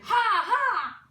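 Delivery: high, loud birdlike cackle; loud, high two-note bird call; bird cry. A narrator's reading. Child turns into a bird.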